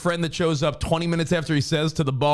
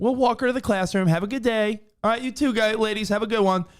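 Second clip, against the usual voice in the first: high, singsong office pleasantries; imitated polite exchange; 8 semitones higher than usual. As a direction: trade courteous goodbyes in a raised mock voice. Acting out cheery goodbyes at office.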